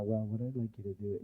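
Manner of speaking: barely audible speaker; quiet talker starting a speech; speaking too quietly. Retold or imitated. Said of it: "People who speak quietly".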